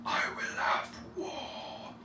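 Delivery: slow, whispered menace; Thorin. Retold or imitated. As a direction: draw each word out in a hushed, mock-crazed voice. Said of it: "which is Thorin going".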